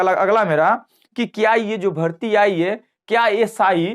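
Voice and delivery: ordinary talk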